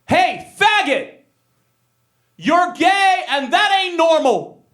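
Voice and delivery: loud, high jeer, first words drawn out; mock bully taunt. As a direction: imitate a loud, aggressive school bully. School bully confronts him in a story.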